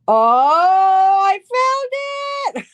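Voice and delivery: loud, high, drawn-out squeal of triumph; gasp, then cry it out high and long; thrilled discovery. Real, not acted. Host finds the clip she was hunting for, thrilled.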